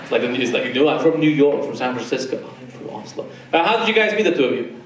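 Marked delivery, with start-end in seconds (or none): none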